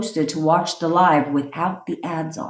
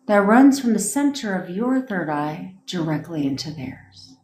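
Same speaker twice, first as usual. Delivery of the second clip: guided meditation. Acted. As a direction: guide them into calm visualization.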